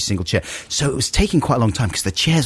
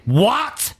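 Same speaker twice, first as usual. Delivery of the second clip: high, slow mock outrage, each word leaned on; hammed-up high-pitched indignation; aggressive matriarch; 10 semitones higher, much slower than usual. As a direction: mimic an affronted woman rounding on someone. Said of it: "It got really aggressive really fast".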